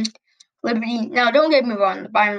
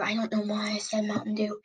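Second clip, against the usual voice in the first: whispered aside; 9 dB quieter than usual. Real, not acted. Embarrassed aside after a slip.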